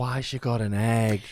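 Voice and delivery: slow drawn-out Scouse impression; lazy Liverpool drawl; slow Scouse accent. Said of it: "in a Scouse accent".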